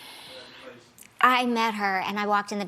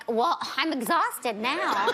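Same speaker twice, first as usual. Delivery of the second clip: mock exhausted, laughing; 4 semitones higher, much slower than usual. Mimics worn-out friend, laughing.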